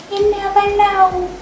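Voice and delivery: high-pitched, lilting saying; playful singsong. Real, not acted. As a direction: lift it high and stretch it out on the repeat.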